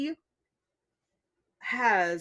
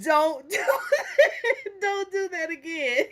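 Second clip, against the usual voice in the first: mock plea through laughter; 6 semitones higher, slower than usual. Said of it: "This is like".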